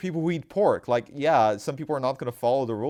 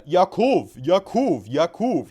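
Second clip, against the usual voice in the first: louder, higher mock Indian-accented name-calling; imitating Indian accent; 4 semitones higher, 6 dB louder, slower than usual. Retelling how a schoolmate kept saying his name, mock Indian accent. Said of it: "he kept saying my name".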